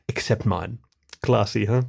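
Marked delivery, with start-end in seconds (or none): none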